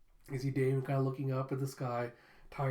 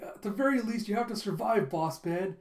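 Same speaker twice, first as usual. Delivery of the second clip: melodramatic plea; 6 semitones higher, 4 dB louder than usual. Retold or imitated. Flunky dramatic rescue plea.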